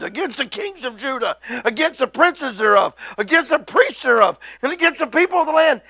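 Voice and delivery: loud, rising pulpit build; preaching. Acted. Preaches verse over [name].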